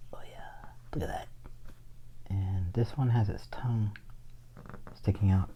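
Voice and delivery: soft whisper while drawing; whispered aside over the sketch. Real, not acted.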